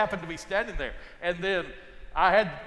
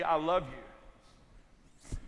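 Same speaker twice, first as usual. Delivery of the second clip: tender; 3 semitones lower, 5 dB quieter, much slower than usual. Retold or imitated. Wife's words, slowed and tender.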